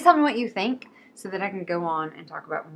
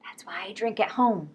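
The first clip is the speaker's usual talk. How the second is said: secretive whisper; whispered aside; 3 dB quieter than usual. Acted. Joking to viewers about the library rule, whispered aside. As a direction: confide it under your breath.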